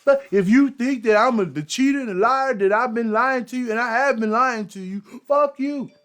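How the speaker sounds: high, loud hammed-up mock fury; mocking angry outburst. Mocking the exposed host cursing out his own followers. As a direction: shout it like the caught man lashing out. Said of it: "Instead of that".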